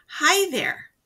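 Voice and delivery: lightly voiced character saying hi; mild cartoon hello; character greeting. Shown as a demonstration. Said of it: "the character's saying".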